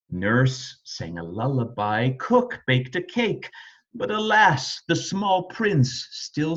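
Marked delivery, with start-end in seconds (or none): none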